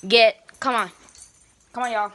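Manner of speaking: loud calling out; calling the dogs. Real, not acted. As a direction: shout for the dogs to come. Shouting at the dogs.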